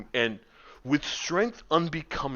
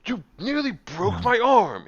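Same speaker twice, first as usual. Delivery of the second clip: high, loud complaint; the wizard rounding on the player who grabbed him, high and loud; indignant wizard; 10 semitones higher, 7 dB louder than usual. Acted. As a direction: scold him for nearly breaking your arm. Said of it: "He goes".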